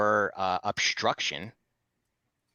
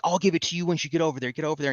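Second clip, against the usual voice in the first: quick, high-pitched mock yell; half-raised retold yell, higher and fast; imitated yelling; 7 semitones higher, 3 dB louder, faster than usual. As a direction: imitate an officer yelling orders in a rush. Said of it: "And he kept yelling".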